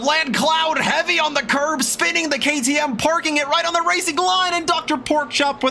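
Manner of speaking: high excited call of the pile-up; excited commentary. Real, not acted. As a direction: rattle off the crash with urgency. Crash called live.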